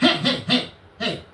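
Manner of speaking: high, rapid-fire interrupting heys; mimicking his outburst. Barging in on the lawyer mid-sentence, high mocking outburst. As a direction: cut in with a high mock yell. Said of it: "He went like that. He wouldn't even let me talk".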